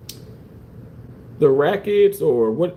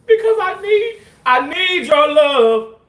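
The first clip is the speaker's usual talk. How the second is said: hammed-up plea; 13 semitones higher, 7 dB louder than usual. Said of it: "performing a begging bit".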